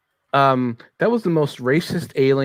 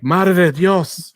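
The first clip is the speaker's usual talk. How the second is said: mock Mexican accent; 6 semitones higher, 4 dB louder, much slower than usual. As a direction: exaggerate a Mexican accent, slow and high. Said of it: "you know, Mexican, uh, you know, uh, going like".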